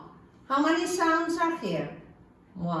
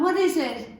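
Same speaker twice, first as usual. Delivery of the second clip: ordinary talk, nothing marked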